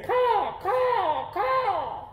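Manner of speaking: very high cawing crow calls; shrill-free high mock crow caws. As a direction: caw like a crow flying off.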